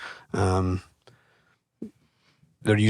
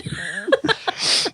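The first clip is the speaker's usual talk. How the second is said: breathy high laugh. Real, not acted. Laughs at her own joke.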